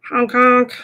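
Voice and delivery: loud playful goose honks; mock goose call. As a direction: honk like a silly goose. Goose noise for a viewer.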